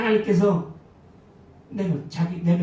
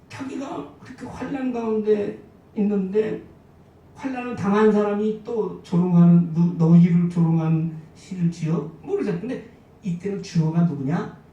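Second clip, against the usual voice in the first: ordinary delivery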